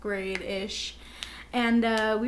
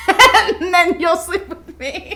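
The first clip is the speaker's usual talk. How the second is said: laughing tease; 6 semitones higher, 9 dB louder, slower than usual. Real, not acted. Joking tease between friends.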